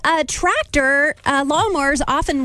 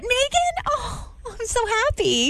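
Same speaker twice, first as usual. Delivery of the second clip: gushing delight; 10 semitones higher, 5 dB quieter, slower than usual. Real, not acted. Cheering the caller.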